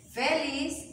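very slow, long pauses between words; word-by-word dictation. Shown as a demonstration. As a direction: dictate it piece by piece as you write. Writing out the example sentence.

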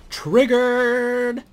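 screamed high and loud; ear-splitting shriek of the word; shouted catchphrase. Acted. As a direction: blast the word like a meme alarm.

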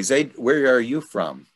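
normal talking voice

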